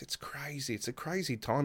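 very soft, near-whispered marvelling; retold hushed awe. Imitating a fellow wrestler on the phone, quietly amazed. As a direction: marvel at it under your breath.

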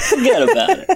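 high, hammed-up New York cliché; New Yorker accent. Shown as a demonstration. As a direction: throw it out in broad New Yorkese. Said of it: "Do a New Yorker accent".

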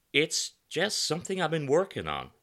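put-on slight American accent, high and loud; a trace of New York American accent; faded American accent. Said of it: "in a faded American accent".

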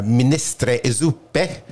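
slow, relished Italian accent; amateur's attempt at an Italian accent. Shown as a demonstration. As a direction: roll out the Italian dish names slowly. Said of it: "in an Italian accent".

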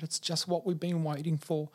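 high, overcome phone voice; retold joyful tearfulness; imitated laughing and crying. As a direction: act out long-awaited emotional relief. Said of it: "And she's laughing and crying".

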